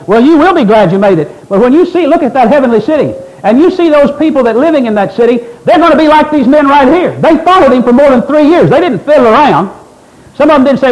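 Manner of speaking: urgent, exhorting sermon delivery; full-force preaching. Real, not acted. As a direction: hammer it home to the congregation.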